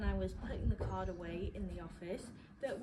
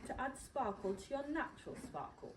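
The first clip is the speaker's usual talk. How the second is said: usual speaking voice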